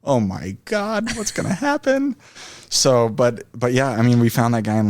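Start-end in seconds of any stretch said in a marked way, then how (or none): 0.0-2.2 slow then squeaky high panic; hammed-up fear; mock panic. Retold panic reaction.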